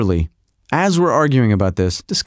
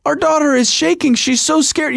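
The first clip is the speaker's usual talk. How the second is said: much higher-pitched mock alarm; imitated alarmed wife; 11 semitones higher than usual. Husband mimics wife noticing their frightened daughter, imitated alarm. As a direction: mimic a wife fretting in a high voice. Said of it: "And then she goes".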